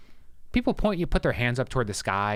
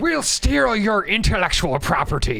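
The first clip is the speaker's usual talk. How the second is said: pirate voice; 11 semitones higher, 6 dB louder, slower than usual. Pretend. Pirate threat in banter.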